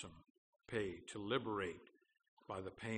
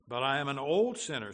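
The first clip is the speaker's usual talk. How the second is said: loud, higher-pitched objection; raised voice of a protesting sinner; 4 semitones higher, 10 dB louder than usual. Acted. Sinner's objection in quoted dialogue.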